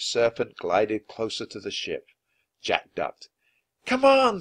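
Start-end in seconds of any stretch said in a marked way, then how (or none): 3.8-4.4 very high, loud urging; urgent coaxing. Acted. Annie urging the serpent to help, coaxing. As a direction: egg it on eagerly.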